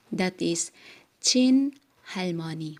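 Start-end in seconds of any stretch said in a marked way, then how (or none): 1.2-1.8 "chin" punched high and loud; stress on chin. Shown as a demonstration. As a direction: hit the word chin hard. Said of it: "stressing the word". (diin sa "chin")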